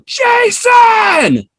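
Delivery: high loud shout of a name; mock yell from afar; calling out. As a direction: yell the name across a crowd. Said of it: "I just hear somebody go".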